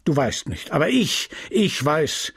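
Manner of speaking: the pharmacist fuming at his apprentice; a lightly voiced furious old apothecary; angry apothecary. Homais interrogating Justin.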